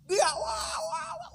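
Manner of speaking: very high wailing cry; mock wail. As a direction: wail like she's at her wits' end. Wife wailing in frustration. (ratapan pura-pura bernada sangat tinggi)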